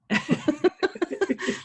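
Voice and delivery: breathy laugh trailing down. Real, not acted. Laughs at own answer.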